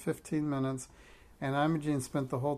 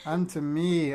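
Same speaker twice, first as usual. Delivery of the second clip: louder, indignant correction; raised-voice retort in a read-aloud; yelling back; 3 semitones higher, 6 dB louder, slower than usual. A narrator's reading. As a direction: yell it back to claim it for yourself. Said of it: "Imogene yelled back at her".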